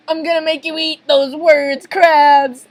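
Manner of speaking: high, loud vow; defiant threat. Acted. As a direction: shout the rival's name at the end. Hero defies Krabs.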